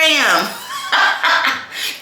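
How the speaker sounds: high-pitched burst of laughter. Real, not acted. Reader tickled by her own send-off, high laugh.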